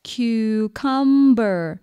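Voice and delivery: Cucumber drawn out very slowly, syllable by syllable; syllable demonstration. Shown as a demonstration. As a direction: count out cu, cum, ber in Cucumber. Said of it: "How many syllables?".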